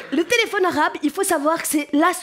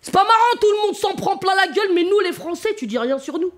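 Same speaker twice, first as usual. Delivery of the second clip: annoyed spectator; 3 semitones higher than usual. Retold or imitated. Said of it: "il me dit".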